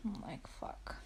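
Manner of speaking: hushed dismayed curse; whispered curse. Retold or imitated. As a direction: mutter it almost silently. Swearing at bad news.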